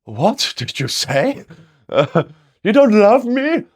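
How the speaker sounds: rising into a high, loud, slow wounded cry; hurt disbelief. Retold or imitated. Coach acting out a partner misreading a tone, hammed-up hurt cry. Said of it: "And I go".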